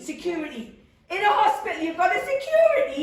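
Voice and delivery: high, mocking laugh at the word "security"; scornful disbelief. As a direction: sneer at the idea.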